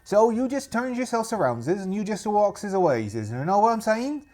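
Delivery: pirate voice, warning them off; threatening rival pirate. Acted. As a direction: threaten intruders in a put-on pirate accent. Rival pirate sends them away.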